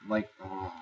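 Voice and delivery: usual speaking voice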